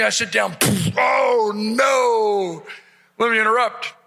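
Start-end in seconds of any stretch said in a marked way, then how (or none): 1.0-2.7 long, swooping mock groan; comic dismay. Big man squeezes down between two chubby guys, comic dismay. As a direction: stretch both words out in hammed-up dismay.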